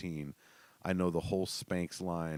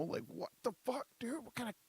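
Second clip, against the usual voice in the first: stunned; 5 dB quieter than usual. Retold or imitated. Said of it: "I'm like".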